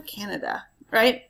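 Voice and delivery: normal talking voice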